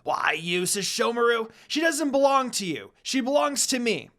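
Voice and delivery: jealous brother lashing out; angry growl. A narrator's reading. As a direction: claim her furiously. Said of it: "began to growl at his older brother".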